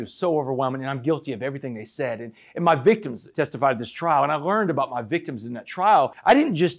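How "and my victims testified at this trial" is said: usual speaking voice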